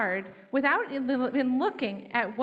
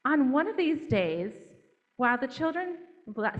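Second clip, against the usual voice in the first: ordinary delivery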